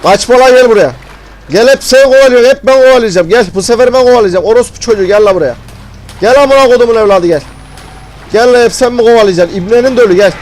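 aggressive taunting shouts. Real, not acted. Gamer daring the monster to come and be chased instead, aggressive taunting shout.

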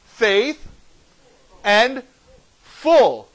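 "Faith", "and", "full" each set apart with pauses; word broken apart. Shown as a demonstration. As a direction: land separately on "Faith" and "full". What the two words are in faithful.

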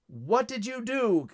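high, drawn-out shock; aghast frog villain demanding an answer; slow, high-pitched alarm; exclaiming frog. A narrator's reading. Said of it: "exclaimed the frog".

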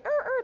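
high mock whimper; dying dog groan. Actor still dying as a dog, high whimper. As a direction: whine briefly like a dying dog. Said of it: "[name]'s still going".

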